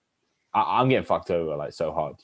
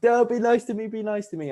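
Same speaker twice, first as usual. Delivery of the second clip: much higher, mocking girl's plea; high girly mock voice. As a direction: parody a swooning woman. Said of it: "they're like".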